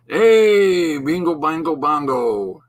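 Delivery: high, loud sing-song "bingo, bango, bongo"; playful exclamation. Pretend. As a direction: toss it out like a cheerful catchphrase.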